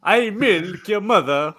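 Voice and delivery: put-on hammy high Italian accent. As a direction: play it as a cartoon Italian. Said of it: "The Italian Fabio".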